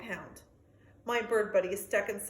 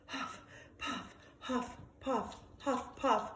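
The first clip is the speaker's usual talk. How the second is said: panting; 5 dB quieter, slower than usual. Acted. Dog panting up big hill.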